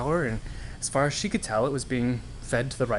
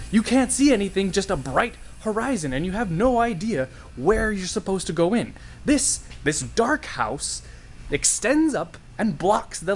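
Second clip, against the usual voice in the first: lecturing sailor; 9 semitones higher than usual. Acted. Sailor explaining the dark house to Karen, high insistent voice. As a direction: explain it like an exasperated old salt.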